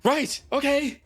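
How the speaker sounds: flustered character. Acted. Player voicing Lyre answering an ally's shout, high and loud. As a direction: call it out high and eager. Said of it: "Lyre's gonna be like".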